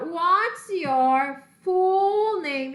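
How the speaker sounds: "full" stretched and punched, question very slow; stress on full. Shown as a demonstration. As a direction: lean hard on "full". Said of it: "isso aqui, ó, full name". (ênfase em "full", bem devagar)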